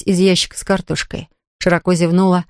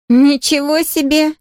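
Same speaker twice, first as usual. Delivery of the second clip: groaning exclamation; 7 semitones higher, slower than usual. A narrator's reading. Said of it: "простонала Лола".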